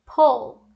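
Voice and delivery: "pull" with a rounded short OO; distinct vowel. Shown as a demonstration. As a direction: say "pull" slowly to show its vowel. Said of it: "elas têm uma vogal diferente". ("pull" com vogal arredondada, PUL)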